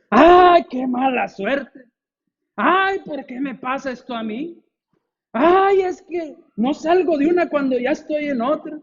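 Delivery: high, slow whiny ay; mock whining. Preacher mimics believers who whine about bad luck, high and whiny. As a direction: moan like a self-pitying complainer.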